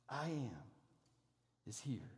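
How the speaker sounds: hushed repetition of the name; awed hush. Acted. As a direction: let it sink in quietly. Preacher repeats words in awe.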